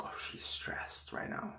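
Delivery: hushed inner whisper, slow; whispered thought. Retold or imitated. Thinking to himself in the dream, whispered. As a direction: whisper it to yourself.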